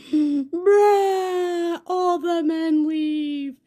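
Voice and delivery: high, loud, drawn-out bawl; mock breakdown wail. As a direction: wail it out like a hammed-up sob. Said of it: "And I broke".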